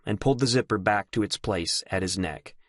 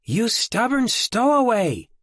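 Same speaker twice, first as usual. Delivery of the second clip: high, slow, half-scolding half-fond; exasperated fondness; 14 semitones higher, 5 dB louder, slower than usual. A narrator's reading. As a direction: scold him with a smile. Mary exasperated yet fond.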